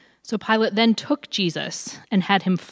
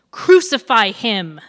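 loud, drawn-out cry; narrated yell, each word slow and forceful; crowd yell; 3 semitones higher, 8 dB louder, slower than usual. A narrator's reading. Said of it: "they yelled".

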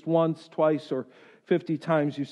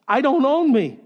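louder, higher, emphatic declaration; forceful repeat; 6 semitones higher, 5 dB louder than usual. Shown as a demonstration. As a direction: drive it home in one quicker push.

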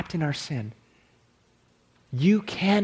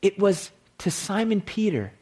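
ordinary delivery

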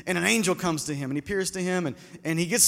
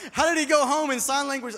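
laughing while asking; 6 semitones higher than usual. Real, not acted. Preacher laughing at his own joke.

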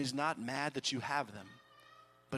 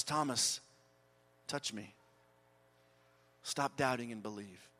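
tender; 6 semitones lower than usual. Acted. Jesus speaks tenderly to doubting Thomas.

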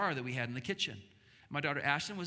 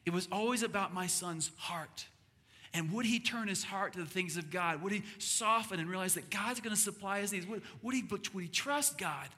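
preaching; 4 semitones higher than usual. Acted. Sermon point, rising to preaching.

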